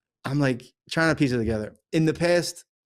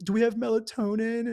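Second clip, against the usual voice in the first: put-on teenage daughter; 6 semitones higher, 3 dB louder than usual. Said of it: "and she's like".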